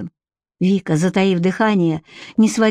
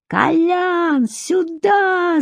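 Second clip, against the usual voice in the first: high, drawn-out alarm call; high-pitched shout, not full volume; yelling; 10 semitones higher, much slower than usual. A narrator's reading. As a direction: yell for help across a clearing. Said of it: "заорал он".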